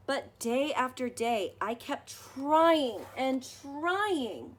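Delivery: put-on gentle read-aloud for children, measured pace; story narration. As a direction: read it like a bedtime picture book. Reading picture book aloud.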